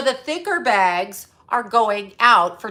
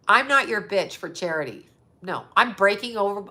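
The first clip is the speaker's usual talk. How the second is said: ordinary talk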